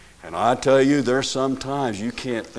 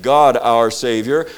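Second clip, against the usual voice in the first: "God" hit loud against the quick aside; stress on GOD; 8 dB louder than usual. Shown as a demonstration. Preacher corrects which name the verse says, stressing "God". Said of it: "Didn't say Jesus our Savior".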